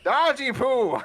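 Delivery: high, loud mock holler; playful shout. Player hollers a nickname at the ship, playful shout. Joking name called toward the ship.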